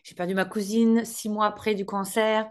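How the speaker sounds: ordinary talk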